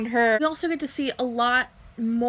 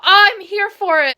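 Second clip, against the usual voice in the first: excited; 5 semitones higher, 7 dB louder than usual. Retold or imitated. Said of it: "and I'm just like".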